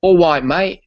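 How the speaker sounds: "All right, mate." clipped London, "All" bright and high; sling out "All right, mate." London-style; London accent. Shown as a demonstration. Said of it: "if you were from London, you would say".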